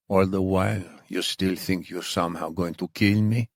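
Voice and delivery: put-on thick-accented captor explaining the torture; measured threat in an Iranian accent; Iranian interrogator, menacing. Narrator voices the accented captor.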